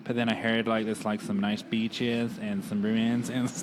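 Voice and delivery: mock American tourist accent. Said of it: "when you do your American accent".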